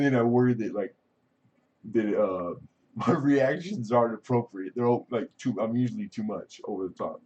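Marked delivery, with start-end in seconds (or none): none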